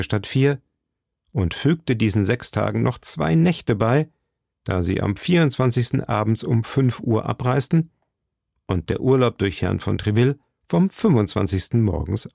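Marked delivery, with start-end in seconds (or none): none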